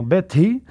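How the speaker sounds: beti with a puff of h after the t; aspirated T. Shown as a demonstration. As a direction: release the t in beti with a burst of breath. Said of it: "la T con la H".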